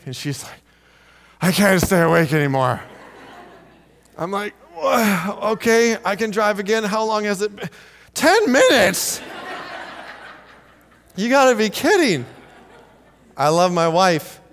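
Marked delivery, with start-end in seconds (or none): 1.4-2.8 mock-pleading wife who is giving up; imitated sleepy wife. Wife wakes husband after her short driving shift, mock weary plea. 4.8-7.7 higher-pitched, groggy just-woken confusion; mock startled awake. Husband woken by wife to take the wheel, startled and muddled. 8.1-12.2 mock incredulous. Husband learns wife drove only briefly, incredulous outburst. Disbelief at wife's short shift.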